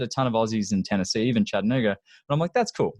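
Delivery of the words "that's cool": much higher, bright retold delight; excited reaction. Telling the host about the oil-change chat, excited. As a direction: sound genuinely impressed.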